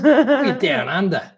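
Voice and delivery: high, slow, hammed-up Aussie accent with a laugh; exaggerated Aussie voice. Shown as a demonstration. Host hamming up Aussie accent for viewer, laughing. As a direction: drawl it like a cartoon Australian. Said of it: "That was my Aussie voice".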